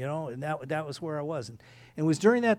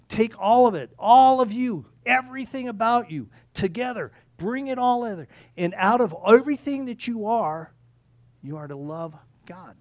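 raised, higher and louder preaching voice; building sermon climax, pitched high; impassioned preaching; 5 semitones higher, 7 dB louder than usual. Real, not acted. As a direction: drive it home to the congregation. Preaches at full force.

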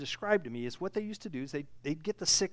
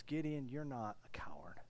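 whispered, much lower and softer; tender whisper; 9 semitones lower, 12 dB quieter than usual. Acted. Angel speaking gently to Gideon, whispered. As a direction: whisper it like a secret promise. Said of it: "he used that irony".

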